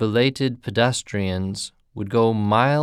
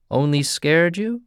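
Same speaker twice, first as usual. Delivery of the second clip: unsympathetic query; 7 semitones higher than usual. Acted. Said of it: "queried the peddler unsympathetically".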